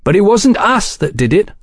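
high-pitched, firm denial; flat denial. A narrator's reading. Velasco denying the agents attacked Falk, stated flatly. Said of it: "Velasco stated flatly".

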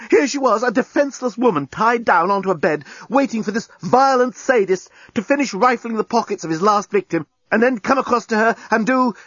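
high, breathless rising panic; panicked. A narrator's reading. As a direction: race through it in mounting terror. Lucy's panicked inner thoughts.

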